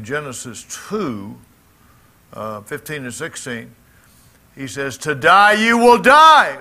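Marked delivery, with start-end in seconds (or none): 5.0-6.6 To die you will die said slow, much higher and louder, leaning on both die; emphatic formation. Shown as a demonstration. Preacher rendering God's warning to Adam, slow and emphatic. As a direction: pound out each word of To die you will die. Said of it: "It's a very emphatic formation".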